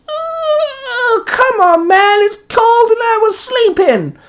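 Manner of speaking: very high squeaky whining creature; Komori. Acted. Sleepy sidekick pulled out of the bag, high-pitched moaning. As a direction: moan it in a tiny, piercingly high voice. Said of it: "Komori moaned".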